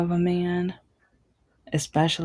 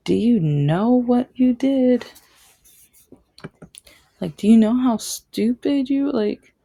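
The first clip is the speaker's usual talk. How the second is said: angry retort; 6 semitones higher, 5 dB louder than usual. Retold or imitated. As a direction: throw the question back at a cheater, exasperated. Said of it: "And I'm like".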